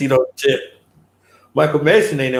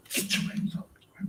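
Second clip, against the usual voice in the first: a breathless whispered threat; movie line whispered; 14 dB quieter than usual. Pretend. Imagining himself in the movie, whispered. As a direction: whisper it like a defiant captive. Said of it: "I'll be right in that movie like".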